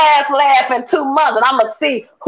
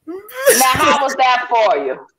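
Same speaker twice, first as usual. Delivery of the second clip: ordinary talk, nothing marked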